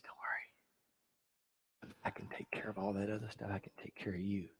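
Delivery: very slow, soft whisper; Jesus whispering to the far crowd; slow tender whisper. Acted. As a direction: breathe the promise into their ear. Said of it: "He says".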